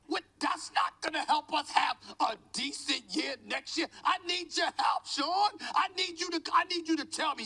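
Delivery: high, fired-up plea; demanding enthusiasm. Real, not acted. Pundit begging a colleague for help selling the trade, fired-up. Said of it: "do it more enthusiastically".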